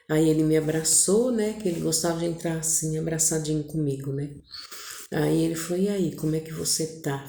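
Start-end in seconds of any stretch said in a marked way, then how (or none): 5.8-7.2 quiet, close murmur of a husband; softly in her ear. Retold or imitated. Husband greets wife in her ear, hushed. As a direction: ask it low, right by her ear. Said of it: "Falou no meu ouvido".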